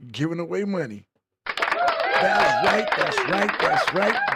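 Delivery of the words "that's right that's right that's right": high, loud hype call; hype shout. Real, not acted. Host hyping listeners for the money giveaway, loud and high. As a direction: pump the crowd up, high and loud.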